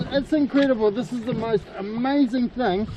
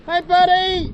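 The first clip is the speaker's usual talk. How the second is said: calling out; 6 semitones higher than usual. Real, not acted. Calling to whale.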